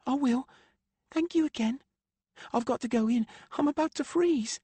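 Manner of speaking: put-on high, light young girl voice; lightly voiced young girl; girl's voice. A narrator's reading. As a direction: voice an eager girl. Macy leaving in cold.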